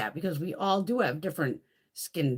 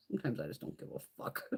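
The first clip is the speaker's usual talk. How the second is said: breathy, almost silent whisper; whispered aside; 6 semitones lower, 12 dB quieter, faster than usual. Real, not acted. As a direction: let it slip out under your breath, unvoiced. Unvoiced aside.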